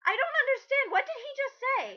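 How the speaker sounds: high, incredulous, with a laugh breaking in; baffled disbelief. Real, not acted. Reviewer reacts to a nonsense sentence, baffled and laughing. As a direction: sound utterly lost by the sentence.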